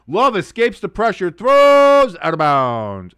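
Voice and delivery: high, loud call with a stretched verb; excited play-by-play. Real, not acted. Streamer calling Love escaping pressure, excited play-by-play. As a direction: hold the throw long and loud, then fall.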